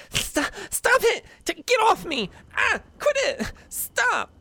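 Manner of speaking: put-on high, panicked child crying out; frightened child. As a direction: scream for help like a scared kid. Child struggling, calls for help.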